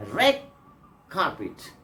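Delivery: red carpet said as "reg carpet", the D turning to G; assimilated red carpet. Shown as a demonstration. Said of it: "The pronunciation is G".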